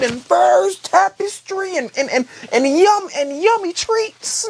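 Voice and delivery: mock furious son, rising to a shout; angry son's rant. Son to his father Corlys, a squeaky high furious rant.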